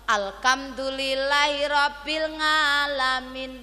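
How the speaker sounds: slow, drawn-out Alhamdulillahirabbil alamin with rural Javanese consonants; old folks' unfluent Arabic. Retold or imitated. Preacher showing how old villagers once recited, unfluent and drawn out. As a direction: imitate elders chanting it haltingly, "ngalamin" for "alamin". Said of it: "wong tuwek-tuwek apa ono sing akeh sing fasih".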